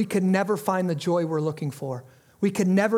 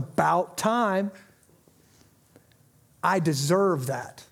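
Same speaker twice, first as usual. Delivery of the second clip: smug pride; 5 semitones lower, slower than usual. Retold or imitated. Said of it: "walk out of the office going".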